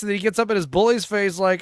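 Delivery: ordinary talk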